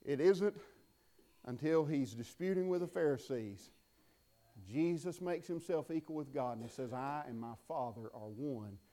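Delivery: quiet, low preaching; hushed intensity. Acted. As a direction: drop to an intimate hush. Preacher drops to hush.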